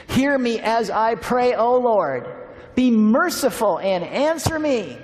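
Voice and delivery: high, loud, slow prayerful reading; fervent prayer reading. Acted. Pastor praying the psalm over the congregation, slow and fervent. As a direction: lift the psalm up as a plea.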